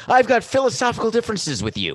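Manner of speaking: mock the phrase in a high voice. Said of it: "I like when anyone says that".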